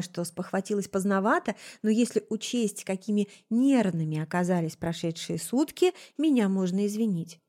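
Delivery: ordinary delivery, nothing marked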